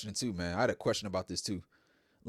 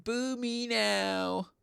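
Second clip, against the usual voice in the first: mock taunt; 14 semitones higher, much slower than usual. Mocking Charlotte's taunt to crowd.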